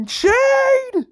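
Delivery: loud, high drawn-out shout; yelling. Acted. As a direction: yell the name urgently over comms. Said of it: "he just starts yelling".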